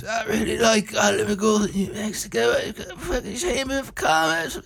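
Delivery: high, mumbly rock-star voice breaking into a laugh. Retold or imitated. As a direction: gush like a stoned rock legend. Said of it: "We just imagine [name] doing this".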